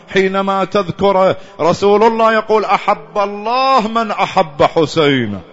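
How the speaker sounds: high, loud impassioned sermon cry; peak preaching. Acted. Preacher crying out the Prophet's words to the mourners, loud and high. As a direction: lift the hall with each phrase.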